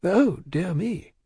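drawn-out polite alarm; dismayed cry. A narrator's reading. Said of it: "cried Mister Lorry".

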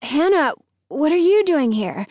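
high, surprised question; startled. A narrator's reading. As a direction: react as if caught off guard.

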